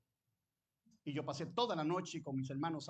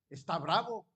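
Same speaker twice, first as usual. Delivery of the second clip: louder, mock stern stepfather; stepfather's challenge; 8 dB louder than usual. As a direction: challenge the angry boy like the stepfather. Stepfather voiced challenging the boy. (el padrastro desafiando, más fuerte)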